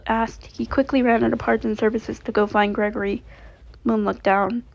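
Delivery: ordinary delivery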